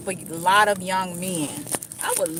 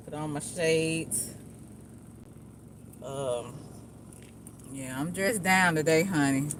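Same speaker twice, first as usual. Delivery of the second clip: normal talking voice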